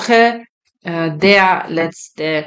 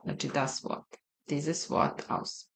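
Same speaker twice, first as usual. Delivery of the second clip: whispered aside; 2 semitones lower, 14 dB quieter than usual. Real, not acted. Quiet aside while teaching.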